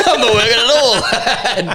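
high, laughing confession. Real, not acted. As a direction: joke at yourself, laughing. Laughing self-jab.

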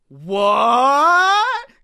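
long rising loud cry; bewildered at the word moon; startled what. Acted. Heroes hear the word moon, loud rising startled cry.

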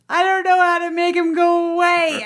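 imitating a whiny, upset toddler; tearful little boy. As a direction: plead like a helpless small boy. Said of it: "he starts crying".

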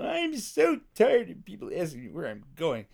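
mock the irritated brush-off; snippy. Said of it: "a little snippy with me".

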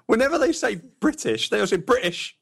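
"British" with a glottal stop for the T; British accent. Retold or imitated. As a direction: say "British" dropping the T. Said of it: "everybody always drops the T".

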